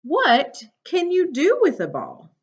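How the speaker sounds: bright, lively storybook read; reading to children. Acted. Teacher reading a picture book to her class, bright and lively.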